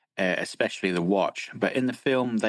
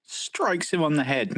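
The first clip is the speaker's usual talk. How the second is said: higher-pitched, mock-indignant repeat; incredulous echo; 7 semitones higher than usual. Cohost objecting to an undersold moment, incredulous echo. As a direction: throw the phrase back in disbelief.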